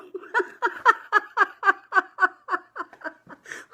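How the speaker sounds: high, loud, long cackling laugh; big laughing fit. Real, not acted. Breaks up at her own story.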